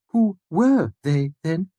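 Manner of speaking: put-on high, muffled odd old man; an oddball asking from behind a door; eccentric old man. A narrator's reading. Puppet voiced.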